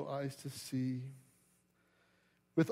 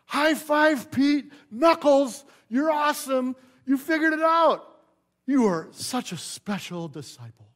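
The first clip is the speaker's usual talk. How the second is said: much higher, loud, shouting high-five praise; mock hyped-up buddy; 8 semitones higher, 8 dB louder than usual. Preacher mocking what Jesus never said to Peter, loud and high. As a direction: ham it up like a frat bro cheering. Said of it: "Jesus does not say".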